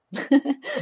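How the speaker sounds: short amused laugh; light laugh. Real, not acted. Laughs after her own remark.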